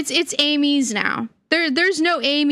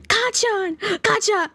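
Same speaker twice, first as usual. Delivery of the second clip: anime character shout; 8 semitones higher, slower than usual. Pretend.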